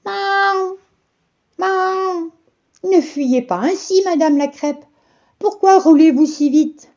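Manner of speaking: high meowing cat; a very high purring cat calling out. A narrator's reading. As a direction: sing it high like a coaxing cat. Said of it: "un chat".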